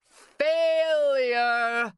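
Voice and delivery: high, loud anguished wail; hammed-up despair, wailed to the ceiling; theatrical moan.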